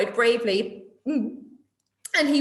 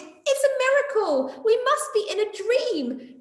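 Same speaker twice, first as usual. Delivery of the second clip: much higher, amazed cheering; excited shout; 9 semitones higher than usual. Acted. The animals shouting praise at Gerald, excited. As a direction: cheer as if seeing a miracle. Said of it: "They shouted".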